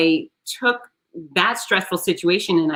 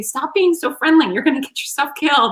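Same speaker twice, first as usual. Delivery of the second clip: high-pitched mock of a fretting mother; worried mom; 9 semitones higher than usual. Imitating her mother warning her in a high voice. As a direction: squeak out mom's old warning in a high voice. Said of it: "she used to say".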